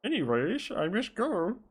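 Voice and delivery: high, affected exit line; put-on detective voice. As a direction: excuse yourself in a silly high voice. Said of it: "I'll do, like, a fucking Bane voice".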